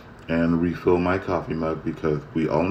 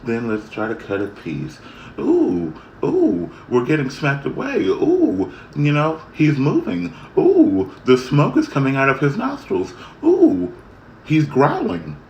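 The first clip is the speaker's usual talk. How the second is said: squealing, much higher mock excitement on each ooh; mocking thrill-seekers; 8 semitones higher than usual. Mocking people who poke the dragon, high squeals. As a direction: mimic silly people gleefully poking danger. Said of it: "everybody just wants to say".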